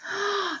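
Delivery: quick soft gasp of wonder. Acted. Teacher gasps in wonder for the children, soft. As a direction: gasp as if amazed by the picture.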